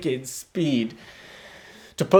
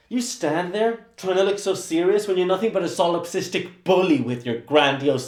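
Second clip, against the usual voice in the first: fast tirade; 3 semitones higher than usual. Acted. Assistant's rapid tirade at painter.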